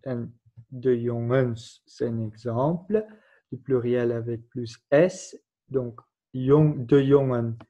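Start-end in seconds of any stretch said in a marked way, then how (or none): none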